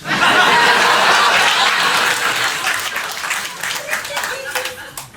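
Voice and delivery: long high laugh trailing down; big laugh. Real, not acted. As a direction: crack up at the accent. Laughing at own joke.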